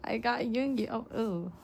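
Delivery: usual speaking voice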